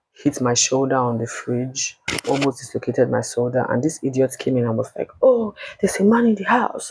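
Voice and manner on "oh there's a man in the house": mocking ex. Mocking ex's fake surprise.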